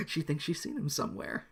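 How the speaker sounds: usual speaking voice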